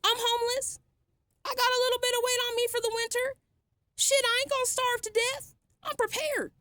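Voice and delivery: sarcastic fake fear over losing the EBT card; mock fretting. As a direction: ham up a pretend fright, sarcastically. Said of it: "And I'm supposed to be fretting".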